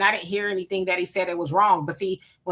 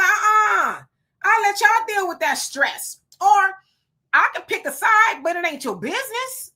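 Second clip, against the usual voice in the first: sassy refusal; 9 semitones higher than usual. Retold or imitated. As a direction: wave them off, higher and sassy. Voices people refusing to choose.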